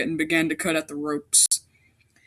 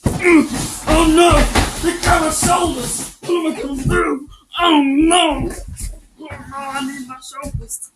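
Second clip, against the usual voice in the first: high, loud, hammed-up wail of alarm; mock panic; 7 semitones higher, 5 dB louder than usual. Macy grabbed from behind, hammed-up panicky wail. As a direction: panic comically over your shoulders.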